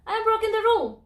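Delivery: high, playful proclamation; announcing to everybody. Pretend. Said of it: "I say to everybody".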